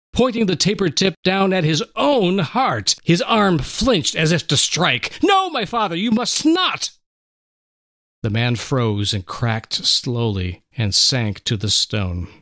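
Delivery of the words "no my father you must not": desperate plea. Acted. Son stopping the raised knife.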